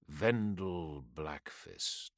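low, slow, dread-laden name; ominous low intoning. A narrator's reading.

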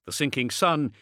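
usual speaking voice

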